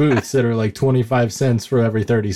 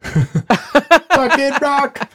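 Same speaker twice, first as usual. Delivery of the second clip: drawn-out exclamation; 12 semitones higher, much slower than usual. Pretend. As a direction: stretch it out high, gleeful. Joking about new show name.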